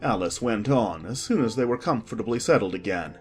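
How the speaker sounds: usual speaking voice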